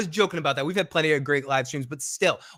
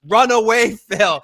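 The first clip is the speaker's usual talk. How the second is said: loud, higher mock yell; yelling in chat; 5 semitones higher, 7 dB louder, much slower than usual. Tony warning Phil in the chat, loud mock yell. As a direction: shout the warning like all caps. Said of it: "Tony's yelling in the chat with capital letters".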